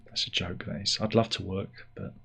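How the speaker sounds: softly muttered aside; quiet aside. Real, not acted. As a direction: drop the voice for the aside. Aside undercutting the joke.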